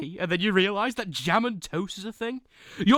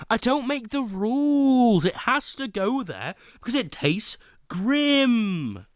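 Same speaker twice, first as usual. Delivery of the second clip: voice crack; 6 semitones higher than usual. Real, not acted. Streamer defending a bad tea rating, voice cracking. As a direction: voice cracks high on the line. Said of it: "that was an audible voice crack".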